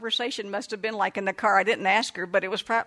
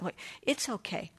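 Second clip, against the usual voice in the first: quiet reassurance; 9 dB quieter than usual. Retold or imitated. Soothing thought to herself.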